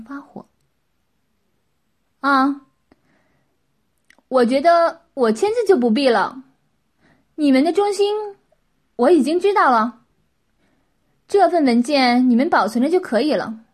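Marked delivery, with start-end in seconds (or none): 2.2-13.6 loud, higher-pitched polite refusal; forced pleasantness. A narrator's reading. Qin declines to sign the document, with forced pleasantness. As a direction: decline with put-on cheer. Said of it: "秦强装笑容说道".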